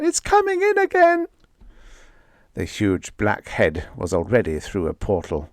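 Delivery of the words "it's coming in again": high shrieking terror; screaming. Acted. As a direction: scream the warning in panic. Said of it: "screamed Marcia".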